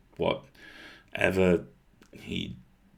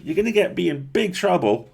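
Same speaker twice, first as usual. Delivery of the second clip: urgent plea; 10 semitones higher, 6 dB louder than usual. A narrator's reading. Boy pleads as she leaves.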